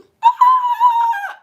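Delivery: high strained note that breaks; cracked sung note. Shown as a demonstration. Demonstrating to viewers that her singing voice is gone, cracked high note. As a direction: try to sing and crack. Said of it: "but if I sing".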